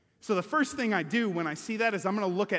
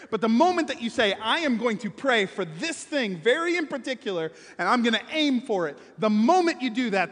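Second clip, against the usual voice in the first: preaching; 4 semitones higher, 6 dB louder than usual. Acted. Preaching on focused prayer.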